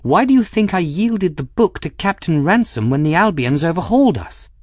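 exasperated, high-pitched and forceful; waspish. A narrator's reading. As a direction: drive the question home waspishly. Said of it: "said in a waspish tone".

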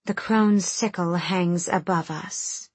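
slow solemn intonation; intoning. Acted. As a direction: chant it like a ritual judgment. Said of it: "Cressida intoned".

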